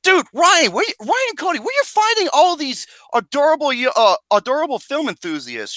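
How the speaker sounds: high-pitched excited gushing; excited disbelief. Real, not acted. Host asking Ryan and Cody about adorable guests, excited disbelief. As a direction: demand an answer in high, amazed excitement. Gushing over another cute film enthusiast.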